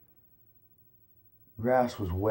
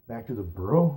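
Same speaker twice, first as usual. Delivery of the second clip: plain reading voice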